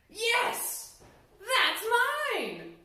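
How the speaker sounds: high and loud, grabbing; snatch the claim, too eager; greedy eager yes. Acted. Greedy logger lies eagerly.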